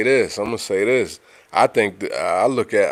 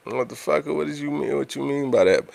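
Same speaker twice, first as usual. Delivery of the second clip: high, pitched-up disbelieving challenge; incredulous comeback; 7 semitones higher, faster than usual. Retold or imitated. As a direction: demand what they meant, half laughing in disbelief. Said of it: "you're like".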